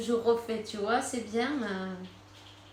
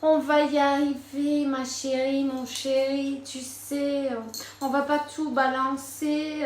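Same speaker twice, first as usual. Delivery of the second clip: higher, louder coaxing voice; mock reassuring partner; 4 semitones higher, 4 dB louder than usual. Imitating a lying lover reassuring the querent, lightly coaxing. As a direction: sweet-talk them into staying. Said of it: "qu'on lui a dit".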